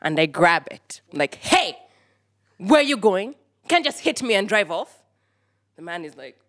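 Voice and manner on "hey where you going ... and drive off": loud, high challenge at a driver; retold angry confrontation. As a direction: call him out, indignant and raised. Confronts driver who hit her.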